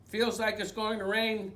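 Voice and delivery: lively picture-book read-aloud; reading to children. A narrator's reading. Librarian reading a picture book to children, animated. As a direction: read it like bedtime story time.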